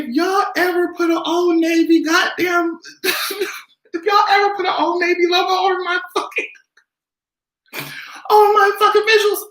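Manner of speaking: outraged laughing shout. Real, not acted. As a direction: yell it in horrified laughter.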